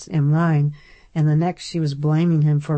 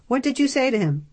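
higher, sharp accusation; accusing sister; 8 semitones higher, faster than usual. A narrator's reading. Darcy confronting Flick about her brother, higher and accusing. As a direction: demand an answer, suspicious.

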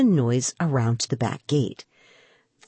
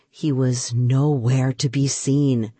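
ordinary delivery, nothing marked

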